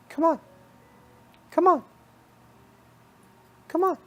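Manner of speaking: high, squeaky-pitched bunny voice; little bunny rabbit. Acted. Bunny rabbit beckoning the listener to follow, high little voice. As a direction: pitch it way up as a tiny coaxing rabbit. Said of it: "you hear it say".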